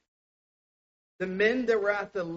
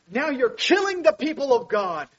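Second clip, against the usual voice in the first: louder, higher accusing shout; angry accusation; 7 semitones higher, 8 dB louder than usual. Retold or imitated. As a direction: hurl it at Moses and Aaron. Crowd accuses Moses and Aaron.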